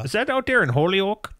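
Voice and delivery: put-on high, lilting Minnesota accent. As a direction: ask like a nosy Minnesotan. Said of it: "the Minnesota voice there".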